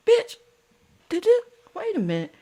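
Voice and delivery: put-on very high, fast squeal of a girl; high mocking girl squeal; mock indignant girl. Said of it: "She goes".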